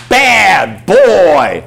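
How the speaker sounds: loud, high, drawn-out dog scolding. Acted. Owner scolds puppy for mess on carpet, loud scolding. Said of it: "I'm gonna spank his butt".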